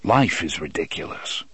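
low, even, unruffled reply; calm retort. Acted. Roland replying to Eddie's outburst, unruffled.

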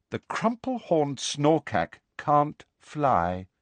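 slow, measured, dignified. A narrator's reading. Luna to Ron, in a slow dignified voice. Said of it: "in a dignified voice".

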